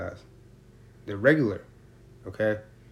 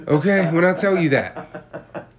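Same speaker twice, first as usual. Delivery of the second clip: emphatic; 3 semitones higher, 7 dB louder than usual. Real, not acted. Stressing how strong edibles are.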